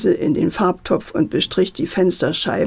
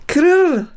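high, rolled crashing noise; imitated glass crash; 8 semitones higher, 4 dB louder than usual. A narrator's reading. Narrator mimics the ball hitting the pane, crashing noise. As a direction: voice a shattering window with a trilled rrr.

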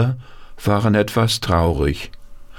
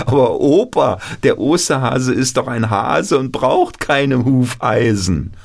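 put-on higher, childlike and breathless with stumbling starts; eager grandchild voice; 6 semitones higher than usual. Grandchild pleading with Opa Paul, eager childlike voice. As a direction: play an excited little grandchild asking.

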